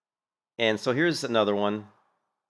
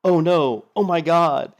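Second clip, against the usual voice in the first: higher, louder mock panic; mock gasping guild members; 7 semitones higher, 6 dB louder, slower than usual. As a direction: ham up the guild's horror. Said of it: "They're like".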